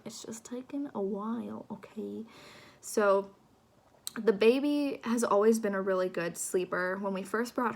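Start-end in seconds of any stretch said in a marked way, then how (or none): 0.0-2.2 whispering. Real, not acted. Sheepish aside about slow uploads.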